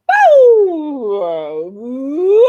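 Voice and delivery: loud drawn-out boo then woo; boo woo warm-up. Shown as a demonstration. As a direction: copy the boo woo call loudly.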